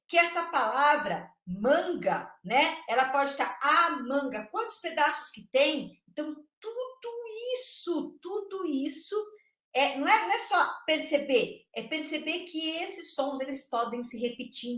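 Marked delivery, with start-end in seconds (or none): 6.1-9.1 hushed emphasis. Real, not acted. Teacher lowers voice emphatically.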